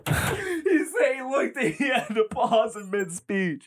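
high, slow words squeezed through laughter; helpless laughter. Real, not acted. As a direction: barely get it out for laughing. Cracking up at the pause.

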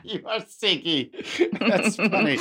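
talking through a laugh. Real, not acted. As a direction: laugh the words out. Laughing at the nickname.

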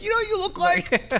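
high mock voice of passers-by; mistaken fans. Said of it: "They're like".

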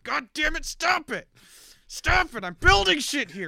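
high, loud exasperated yelling; angry shout at brothers. Retold or imitated. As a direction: snap at them to stop, furious. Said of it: "Dorn just looks across the table and is like".

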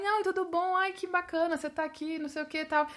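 high, chatty mock greeting; imitating excited friend. Blonde friend greeting him in Portuguese abroad, high chatty imitation. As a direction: pitch it up like a friend gushing hello. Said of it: "a Michelle começou a ficar falando em português comigo".

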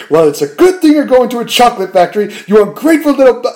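hammed-up old man scolding a spoiled kid; mock-furious grandpa, cut off mid-insult; grumpy grandpa snap. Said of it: "Grandpa George just goes".

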